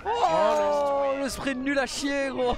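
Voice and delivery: loud, high frustrated outburst; raging gamer. Real, not acted. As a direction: yell in frustration at the game. Rages at teammate's bad play.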